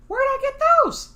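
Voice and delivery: put-on high puzzled boy voice; kid voice. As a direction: wonder aloud like a kid. Kid character asks.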